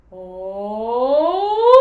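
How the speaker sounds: loud, rising howling siren on Oh. Shown as a demonstration. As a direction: let the Oh climb like a howling siren, free and playful. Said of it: "this is a siren sound".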